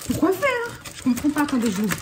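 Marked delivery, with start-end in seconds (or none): 0.1-0.8 pourquoi faire pushed much higher in disbelief; incredulous repeat. Real, not acted. Vlogger stunned by inedible decorations, asking again much higher. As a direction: repeat the question with rising disbelief. Baffled by useless sugar decorations.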